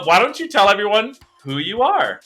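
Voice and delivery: ordinary talk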